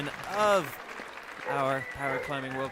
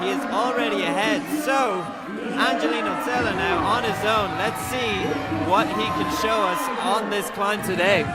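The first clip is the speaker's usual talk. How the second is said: rising, louder live climbing commentary; excited play-by-play; 5 semitones higher, 6 dB louder than usual. Real, not acted. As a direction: call the climb as it happens.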